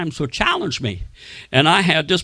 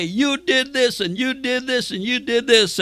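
devil accusing; 7 semitones higher than usual. Retold or imitated. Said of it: "the devil's gonna try to come back and say".

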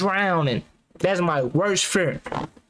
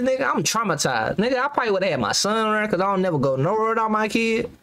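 ordinary delivery

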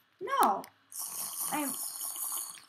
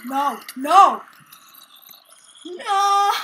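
loud cries, climbing higher; panicked shout; 6 semitones higher, 11 dB louder, much slower than usual. Real, not acted. Player about to die in game, panicked shout. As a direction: shout in mounting desperation.

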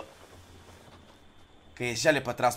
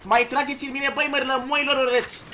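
raised, louder challenge to opponents; heated taunting; 5 semitones higher, 5 dB louder than usual. Real, not acted. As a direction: scold the attackers and dare them. Taunts attackers in game fight. (provocare aprinsă, cu voce ridicată)

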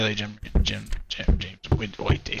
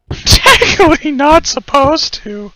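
high laugh through the words; 14 semitones higher, 10 dB louder than usual. Real, not acted. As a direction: giggle while confessing. Laughing confession.

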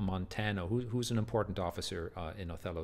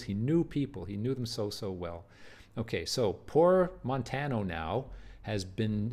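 normal talking voice

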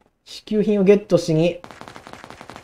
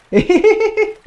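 giggle; 14 semitones higher, much slower than usual. Real, not acted. Laughs at own fall.

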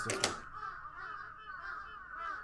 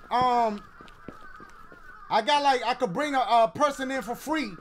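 flirty shout-out; 6 semitones higher, 5 dB louder than usual. Acted. Calling out to a game character.

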